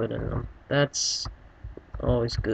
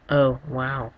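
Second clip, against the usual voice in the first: ordinary delivery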